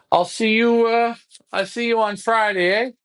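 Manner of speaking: mocking taunt. Flyer gloating at his rival before Friday's race. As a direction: toss the taunt up high and cocky.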